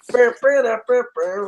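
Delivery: high buzzing lips like a wrestling entrance theme; mock entrance-music buzz. Buzzing an entrance tune for the stream chat. Pretend John Cena entrance.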